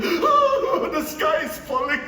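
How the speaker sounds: high, loud whiny wail, laughing through it; mock whining. Speaker mocks his own whining after losing his job, hammed-up high whine. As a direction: ham up a self-pitying child crying doom. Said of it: "whining and groaning".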